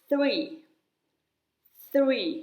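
"three" with the tongue out on th; airy TH. Shown as a demonstration. Teacher modelling the number "three", airy TH. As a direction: say "three" with air through the teeth for th. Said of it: "หนูต้องออกเสียงว่า".

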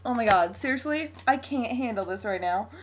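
normal talking voice

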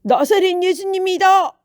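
high, loud announcing shout; excited bystander calling out; crowd member's cry. A narrator's reading. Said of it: "사람들의 소리가 들렸어요".

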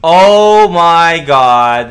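slow, high, loud oh my god; drawn-out exclamation. Real, not acted. Streamer seeing the duck stare at his camera, drawn-out exclamation. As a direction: stretch it out in disbelief.

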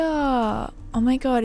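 usual speaking voice

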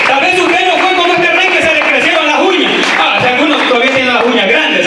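sudden high-pitched sermon delivery; fired-up preaching. Real, not acted. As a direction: challenge the congregation at a high pitch. Preaches at congregation.

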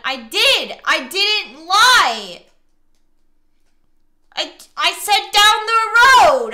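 loud, drawn-out, indignant protest; defensive yelling. Real, not acted. Player insisting to the game partner it was no lie, defensive. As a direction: yell it in exasperated self-defence. Defending against the game's accusation.

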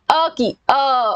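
slow drawn-out अ sound, अ की, अ. Shown as a demonstration. Teacher demonstrating अ की, अ slowly to the class. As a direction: stretch the अ so the class hears it. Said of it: "आवाज आनी चाहिए".

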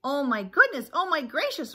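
loud, high flustered chick; rasped panic. Acted. Chicken Little answers the goose, worn-out mock alarm. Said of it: "rasped Chicken Little".

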